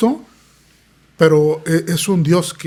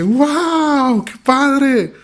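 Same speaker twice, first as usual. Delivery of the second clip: high, excited cry of delight; retelling his wife's thrilled outburst; near shout of joy; 10 semitones higher, 3 dB louder, much slower than usual. Retold or imitated. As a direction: nearly shriek it with happy surprise. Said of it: "casi que grita y dice".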